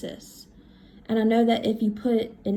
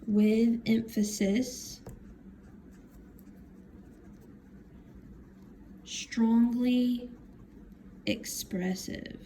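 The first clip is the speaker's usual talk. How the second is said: spaced out, trailing softer at the end; slow dictation; 5 dB quieter, much slower than usual. Real, not acted. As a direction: say it piece by piece while writing. Writing the meaning while saying it.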